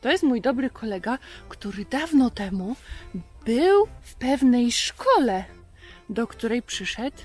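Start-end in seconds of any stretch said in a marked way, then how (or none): none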